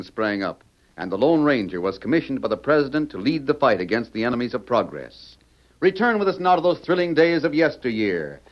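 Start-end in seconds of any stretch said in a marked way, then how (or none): 5.8-8.4 high, dramatic announcer read over music; radio announcer. Acted. Announcer introducing the show to listeners, grand and dramatic. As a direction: sell the thrill of the show.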